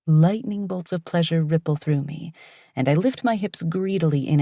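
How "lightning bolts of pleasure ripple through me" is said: usual speaking voice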